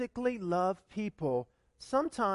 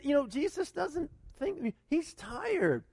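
ordinary delivery

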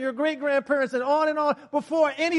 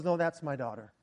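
tender God; 8 semitones lower, 8 dB quieter than usual. Retold or imitated. God voiced tenderly in story.

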